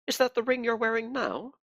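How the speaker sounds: a cold, pious interrogator; cold pious accusing. Acted. Inquisitor presses about ring.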